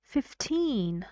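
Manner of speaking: put-on "fifteen" with TEEN stressed and drawn out; fif-TEEN, the second syllable carrying the weight. Shown as a demonstration. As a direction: lean on the end of "fifteen". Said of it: "when I say the word alone".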